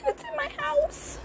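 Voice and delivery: high, soft laughing squeal; real giggly squeak; amused alarm. Real, not acted. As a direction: laugh through it in a small high voice. Startled by a moth, laughs.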